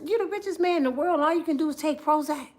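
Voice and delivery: fast, hushed mock lecture; mock scolding. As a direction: scold him like a baffled friend. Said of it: "I said".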